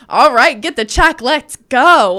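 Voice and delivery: hammed-up let's go; joking. Boyfriend jokingly rushing out after her request. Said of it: "like, jokingly".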